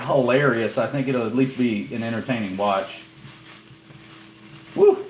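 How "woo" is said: sudden high whoop at a sting; pained whoop. Real, not acted. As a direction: let out a high, startled "woo". Eyes burning from onions.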